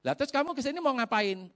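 light high questioning voice; suspicious uncle. Retold or imitated. (nada tinggi curiga)

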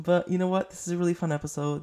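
ordinary talk

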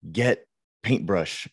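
put-on slow, clipped-word demo of baby talk; slow simplified command to a child; telegraphic toddler phrasing. Shown as a demonstration. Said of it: "you're leaving out certain words".

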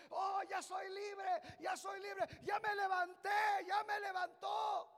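loud, slow cry of freedom; joyful shout. Acted. Healed man shouting to the crowd, loud and joyful.

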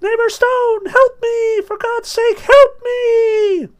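very high, loud, piping plea; a tiny voice crying out to be saved; desperate squeak. A narrator's reading. Trapped soul begs Jabez Stone for help in a loud piping squeak. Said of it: "it squeaked".

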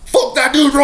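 very high hammed-up yell; joining the outrage. Storyteller joins friends against intruder, mock outraged yell. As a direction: yell it like a newly converted ally.

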